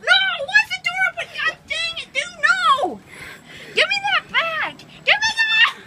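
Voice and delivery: high pitched panicked yelling; frantic angry shouting. Real, not acted. Brother grabbing his toys.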